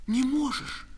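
high, anxious question without shouting; a touch of fright; frightened question. Acted. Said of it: "испуганно спрашивала Катя".

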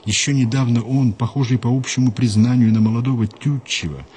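ordinary delivery

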